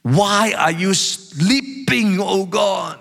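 high, slow cry of indignation; emotional outcry. Acted. Psalmist demanding that a sleeping God answer, emotional outcry. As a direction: cry out to heaven, drawing out each word. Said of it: "Can you feel the emotional tone?".

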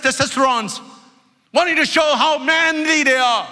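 high, shouted preaching; fiery exhortation. Acted. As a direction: shout it out at the congregation. Pastor exhorts the congregation, shouting.

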